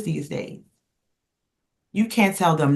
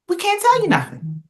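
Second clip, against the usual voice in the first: "We can't tell you nothing" said much higher, with TELL stressed and falling; emphatic repeat. Shown as a demonstration. Host repeating her point to listeners, high and exasperated.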